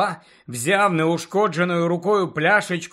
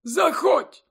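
put-on much higher-pitched old headmaster voice; tired headmaster; 12 semitones higher than usual. A narrator's reading. Dumbledore to the knock at his door, tired. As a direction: call out wearily in a high character voice. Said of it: "Голос у нього звучав стомлено".

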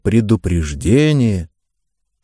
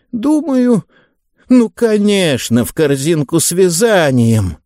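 put-on high, sweet old lady; light elderly woman's voice; 7 semitones higher than usual. Mrs Lancaster voiced.

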